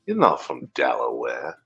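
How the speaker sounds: usual speaking voice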